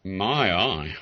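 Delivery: ordinary delivery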